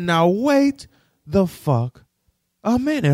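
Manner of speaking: high, loud, spaced-out outburst. Retold or imitated. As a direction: bellow each word in disbelief. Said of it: "I said".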